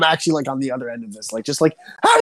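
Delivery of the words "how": high, loud ranting yell; hammed-up fuming at a troll; mock furious yell. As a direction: shout him down in mock rage. Acting out his Twitter rage.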